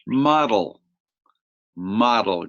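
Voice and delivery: teacher modelling a word; model word model. Shown as a demonstration. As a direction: Model said clearly, MOD-el. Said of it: "Can you say that word?".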